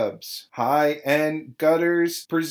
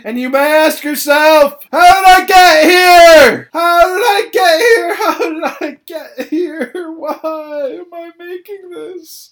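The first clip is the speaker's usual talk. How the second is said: far higher and louder, laughing and crying; mock despairing shout; 13 semitones higher, 15 dB louder than usual. Real, not acted. Host wails about making the podcast, mock despair. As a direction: scream it like an existential crisis.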